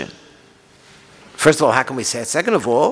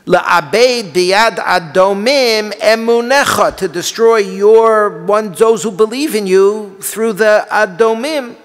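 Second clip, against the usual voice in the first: impassioned lament; 8 semitones higher, 5 dB louder than usual. Acted. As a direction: plead against God at full force. Impassioned reading of the kina.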